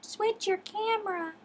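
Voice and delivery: high-pitched mock command; Ida. Ida telling streamer to flip the view, high imitation voice. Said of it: "And Ida says".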